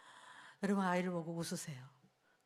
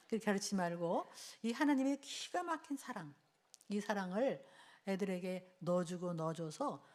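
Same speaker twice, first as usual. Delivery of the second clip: usual speaking voice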